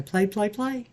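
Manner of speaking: sing-song play. Real, not acted. Eager to start stamping.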